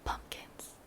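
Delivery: breathy hushed whisper of delight; a soft thrilled whisper; excited whisper. Real, not acted. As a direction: whisper it like a secret treasure. Excited whisper on new pumpkins.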